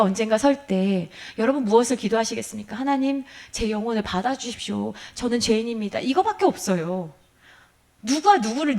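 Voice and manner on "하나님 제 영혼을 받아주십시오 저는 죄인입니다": hushed prayer. Acted. Voicing a dying prayer.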